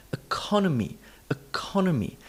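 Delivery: economy with high CON and whispery reduced vowels; stress rhythm. Shown as a demonstration. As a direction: let CON carry economy, the rest nearly vanishing. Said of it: "This is the rhythm".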